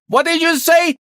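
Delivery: high, loud shouted question; shouting. Acted. Chief unable to hear the inspector, shouting. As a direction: shout because you can't hear. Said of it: "Stop shouting!".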